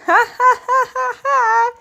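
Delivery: high, slow, unhinged laughter that won't stop; unnatural laugh. Acted. Alone and abandoned, laughing wildly at the empty house. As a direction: cackle like you've lost your mind. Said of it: "That's not a natural laugh".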